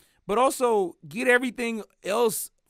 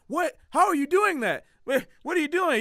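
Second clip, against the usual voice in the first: higher and louder, an astonished bystander; amazed onlooker; 8 semitones higher, 4 dB louder than usual. Pretend. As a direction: gasp out the questions in disbelief. Acted onlooker amazed by sudden player.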